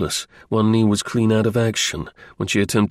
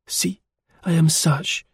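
higher voice with a light French lilt; French woman's reply; 7 semitones higher than usual. A narrator's reading. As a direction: reassure him with French phrasing. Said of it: "like a French".

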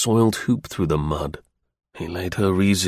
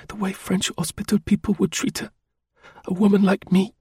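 higher woman's voice, urgent and hushed at first; passionate plea; 10 semitones higher than usual. A narrator's reading. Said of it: "she said in a passionate whisper".

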